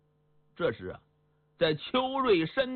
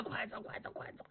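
much softer, to himself; hushed; 15 dB quieter, faster than usual. A narrator's reading. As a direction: hurry himself on under the breath. Inner thought, sneaking away.

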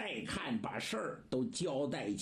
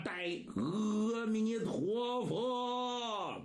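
slow, drawn-out, higher call of a monk at the gate; loud call; 6 semitones higher, much slower than usual. Acted. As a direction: hail them long and ringing from the gate. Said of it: "高声喊喝". (老僧在门口高声拖长喊喝)

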